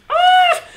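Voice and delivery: short high scream of nerves; excited scream. Real, not acted. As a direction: yelp it out high, stress of the final seconds. Nervous, final seconds of game.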